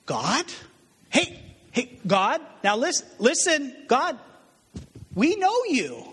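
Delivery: high, pleading voice crying out to God. Retold or imitated. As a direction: beg God with a high, whiny plea. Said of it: "they cry".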